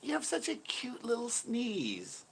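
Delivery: high, slow gushing coo; cooing over cuteness. Acted. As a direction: fawn over it in drawn-out baby talk. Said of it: "It's like".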